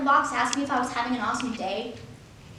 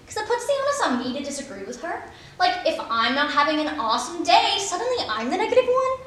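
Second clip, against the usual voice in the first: ordinary talk, nothing marked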